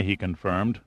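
ordinary delivery, nothing marked

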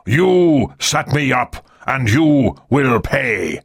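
a hint of a stern naval commander; lightly voiced angry admiral. A narrator's reading. Admiral blaming Ri for his ships, menacing.

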